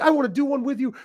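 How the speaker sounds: high, eager retold gush; a wrestler begging to collab, retold high and eager; fired up. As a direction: pitch it up like an excited fan. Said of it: "He was all fired up".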